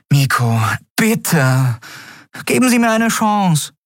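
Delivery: high, bright-eyed plea to come along; eager young Miko. Acted. Miko asking the captain to come along, high eager voice. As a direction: ask to join like a thrilled youngster.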